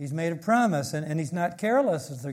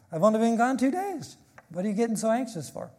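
light, higher amused voice, a small laugh midway; God speaking casually; 6 semitones higher, faster than usual. Acted. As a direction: lightly voice an unbothered God shrugging off the worry. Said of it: "he says".

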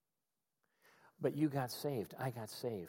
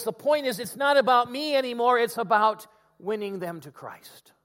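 louder, higher, slowing at the end; preaching as Paul; 6 semitones higher, 7 dB louder than usual. Retold or imitated. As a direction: drive home the point. Paul's conclusion.

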